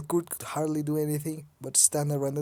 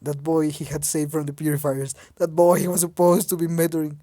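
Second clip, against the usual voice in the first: ordinary delivery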